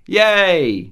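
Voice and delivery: hammed-up happy hurrah; playful cheer. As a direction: cheer as if toasting. Mock cheer after a toast.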